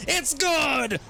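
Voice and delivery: high, loud last-seconds call; thrilled crunch-time commentary; climactic play-by-play. Acted. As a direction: build to a shouted score, then ease off. Live play-by-play.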